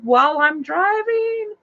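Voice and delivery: high, drawn-out wail of dismay; retold panic. Telling friends about a spider scare while driving, retold panic. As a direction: stretch it out high, in disbelief.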